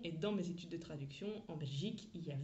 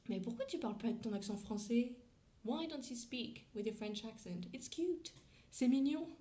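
higher-pitched mock colleague asking a question; collègue anglais imité; 5 semitones higher than usual. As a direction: imitate a curious colleague, pitched up. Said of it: "il y en a un qui me demande". (collègue imité, voix plus aiguë)

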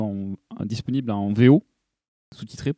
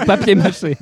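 giggling through the words; laughing; 8 semitones higher, 6 dB louder than usual. Real, not acted. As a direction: laugh while saying it. Echoing, laughing.

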